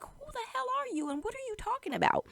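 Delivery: high, fast, baffled demand; mock bewilderment rushed out high; confused. Confused woman challenging the stranger, bewildered. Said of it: "she's so confused".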